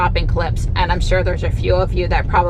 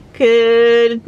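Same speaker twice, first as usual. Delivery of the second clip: slow, higher, louder sing-song; pet greeting; 6 semitones higher, 4 dB louder, slower than usual. Real, not acted. Greeting Ruby, slow sing-song hello.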